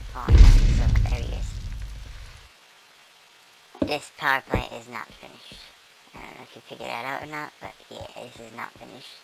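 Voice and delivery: ordinary delivery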